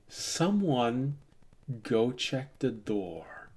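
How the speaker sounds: soft, slow, measured order; Sierra. Acted. Leader speaking into the silence, quiet slow order. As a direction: give a hushed order after the chaos. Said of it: "You hear the voice of Sierra saying".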